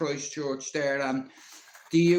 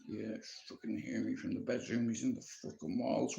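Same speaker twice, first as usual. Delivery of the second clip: much softer grumble under his breath; muttered aside; 4 semitones lower, 10 dB quieter than usual. Retold or imitated. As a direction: grumble so granny can't hear. Muttering reply to granny.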